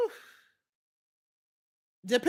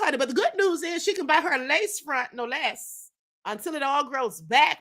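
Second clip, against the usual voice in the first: ordinary talk, nothing marked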